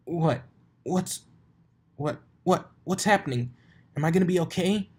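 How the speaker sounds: a pony terrified she is turning to stone; panicked fear. Acted. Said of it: "desperation and fear".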